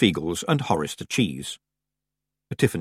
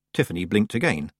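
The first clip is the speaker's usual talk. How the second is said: usual speaking voice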